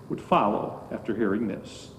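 normal talking voice

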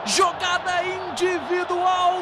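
shouted goal call, stretched GOL; explosive goal commentary. Acted. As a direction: belt out the goal, drawn out.

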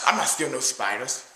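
normal talking voice

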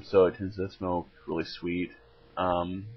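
talking around pipe stem; pipe held in mouth. Real, not acted. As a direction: lips partly closed on stem. Seen 0: Pipe stem held at lips while speaking; briefly lowered around 3.8-5.3.

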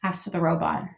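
usual speaking voice

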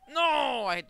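loud, high, drawn-out wail easing off; real dismayed cry. Real, not acted. Gamer hitting the post, loud dismayed cry. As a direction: cry out at the near miss. Missed shot in the game.

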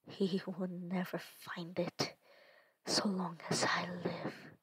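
low, defiant whisper; muttered whisper. Acted. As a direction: mutter it through the pain. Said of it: "she mutters to them".

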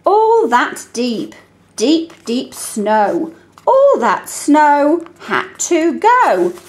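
put-on slow, deliberate read-aloud to a child. Shown as a demonstration. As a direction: read each word slowly and clearly. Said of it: "so I might do something like this".

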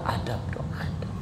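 "adab dong, adab" whispered, fading out; an intimate whispered admonition. Real, not acted. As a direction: whisper "adab" as a hushed reminder. Preacher drops the word to a whisper.

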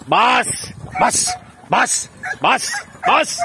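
short, high, punched commands; high-pitched training command; urging the dog. Real, not acted. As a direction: fire off single sharp orders to a dog.

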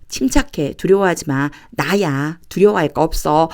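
usual speaking voice

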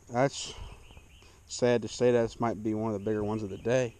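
ordinary talk

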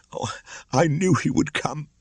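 a mother who thought she'd lost him; sobbing mother. Acted. Mother to her returned son, sobbing with relief. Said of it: "she sobbed at last".

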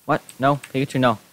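usual speaking voice

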